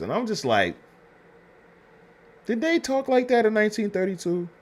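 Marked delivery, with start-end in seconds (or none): 2.4-4.5 high, disbelieving voice, pitched well up; incredulous question. Real, not acted. Reviewer asking viewers in disbelief, high and incredulous. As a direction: ask it like you can't believe it. Reviewer doubting period dialogue.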